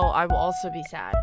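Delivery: ordinary delivery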